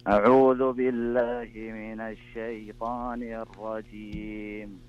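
usual speaking voice

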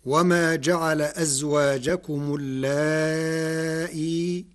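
slow, chanted tarteel; slow melodic recitation of the verse; Quran recitation. Shown as a demonstration. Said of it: "قرأ ورش كلمة اللائي".

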